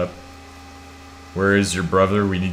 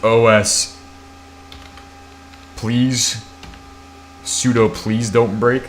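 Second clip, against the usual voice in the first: mock pleading; 3 semitones higher, slower than usual. Reads command as a plea.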